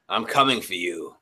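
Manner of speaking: menacing villain. Retold or imitated. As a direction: growl it like a dark king. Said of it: "what are you gonna say".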